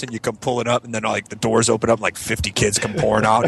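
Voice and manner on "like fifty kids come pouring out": usual speaking voice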